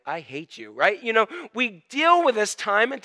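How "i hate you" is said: low, soft aside; quiet, joking grudge; muttered mock hatred. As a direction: mutter the resentment under your breath. Acted reply to rain lover.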